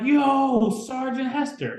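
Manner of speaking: high, eager hail; light up at an old friend; excited greeting. Retold or imitated. Greeting his old recruiter in the gym, excited and high.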